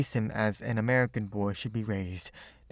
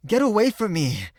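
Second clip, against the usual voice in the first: loud, high furious yell; angry shout; 10 semitones higher, 8 dB louder than usual. A narrator's reading. Boy ashamed before his friends, shouting at the girl. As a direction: shove her off with your voice. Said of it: "he shouted".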